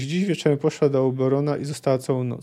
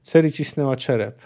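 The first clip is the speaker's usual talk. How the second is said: plain reading voice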